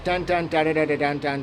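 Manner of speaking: sung-out ringtone jingle; a hummed ringtone melody; hummed phone alert. Retold or imitated. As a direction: mimic the creepy phone tone with nonsense syllables. Imitating phone alert tune.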